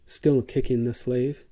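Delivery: ordinary delivery, nothing marked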